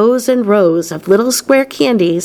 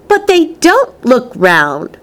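high, slow, emphatic protest; stubborn protest; 6 semitones higher, slower than usual. A narrator's reading. Veruca disputes Wonka's claim about candies, stubborn. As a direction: lean on each word in objection. Spoilt girl argues.

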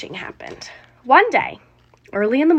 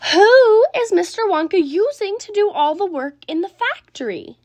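put-on much higher, eager child's question; 8 semitones higher, 4 dB louder than usual. A narrator's reading. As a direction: press grandpa for the answer. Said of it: "cried Charlie".

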